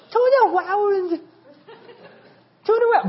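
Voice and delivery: high, squeaky mock child doing Arnold; little daughter's Schwarzenegger accent. Father imitating his youngest daughter's accent, high-pitched mock Schwarzenegger. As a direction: imitate a tiny girl attempting an Austrian accent. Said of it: "And so little Sophia, my youngest".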